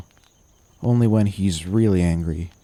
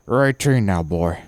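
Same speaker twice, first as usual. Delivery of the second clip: mild shout; 3 dB louder than usual. A narrator's reading. Masters cutting off the boy's memories, mild shout. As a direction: cut in with a restrained shout. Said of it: "Master shouts".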